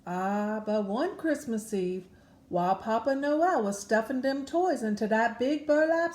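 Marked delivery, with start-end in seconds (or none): none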